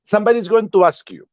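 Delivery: normal talking voice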